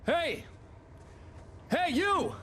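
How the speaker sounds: calling out. Acted. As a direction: hail someone from a distance. Calls to stranger for help.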